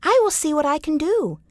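put-on a boy agreeing to help the tree; little boy Yamo. A narrator's reading. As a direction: promise brightly like a young boy. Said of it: "said Little Yamo".

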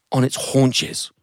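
plain reading voice

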